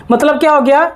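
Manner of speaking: ordinary delivery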